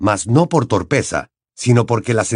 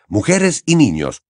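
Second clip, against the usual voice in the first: ordinary delivery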